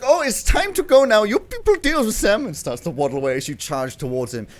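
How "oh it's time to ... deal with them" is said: loud, high mock-panicked bear; panicky bear character. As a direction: cry out in a high put-on voice. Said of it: "It's like".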